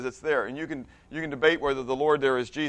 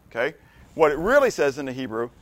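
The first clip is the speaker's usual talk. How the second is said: ordinary talk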